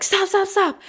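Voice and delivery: high, sharp mock yell of stop; alarmed shout. Imitating the alarmed driving examiner, high shout. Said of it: "the guy's like".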